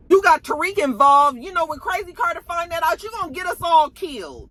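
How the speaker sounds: imitated furious cop, pitched high; high, forceful telling-off, acted out; angry scolding. Bad cop lady stops her accomplice, imitated angry scolding.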